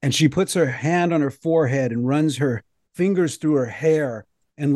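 ordinary talk

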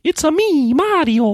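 very high Italian-cartoon "It's a me"; Mario voice. Co-host mocks the old Mario voice. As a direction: squeak it out like the cartoon plumber. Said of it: "it was like".

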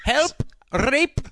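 high, hammed-up calls for help; mock cry for help. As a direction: yell it out in a high mock panic.

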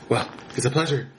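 usual speaking voice

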